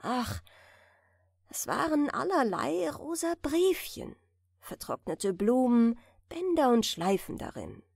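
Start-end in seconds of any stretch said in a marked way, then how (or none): none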